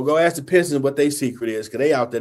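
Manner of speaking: normal talking voice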